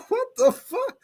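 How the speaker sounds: very high-pitched incredulous question; comic, high rising disbelief; mock disbelief. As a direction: replay your bafflement in a squeaky-high voice. Retelling his shocked reaction, laughing.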